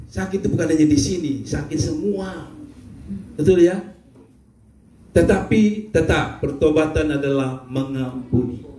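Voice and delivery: normal talking voice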